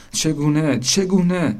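high, insistent questioning; urgent wondering. A narrator's reading. Boat hunting for a way to sink, urgent wondering. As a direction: repeat it rising in pitch.

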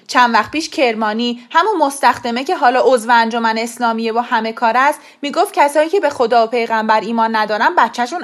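loud heated rant; angry father. A narrator's reading. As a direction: argue hard with the wife, ashamed. (پدری عصبانی با فریاد در دعوا)

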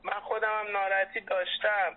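usual speaking voice

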